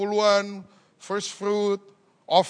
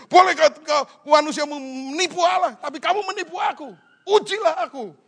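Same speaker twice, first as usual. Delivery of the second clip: raised, high stern divine voice; angry God; 6 semitones higher, 4 dB louder than usual. Retold or imitated. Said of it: "Dia lagi ngamuk". (suara Tuhan marah yang keras)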